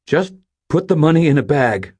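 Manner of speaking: high, loud instruction; tense command. Acted. Robber tells the cashier to bag the money. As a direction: tell him firmly what to do.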